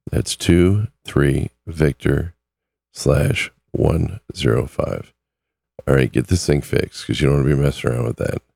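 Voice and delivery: normal talking voice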